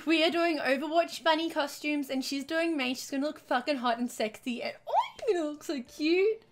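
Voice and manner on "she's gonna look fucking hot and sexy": normal talking voice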